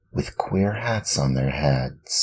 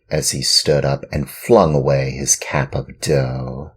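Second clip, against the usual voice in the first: plain reading voice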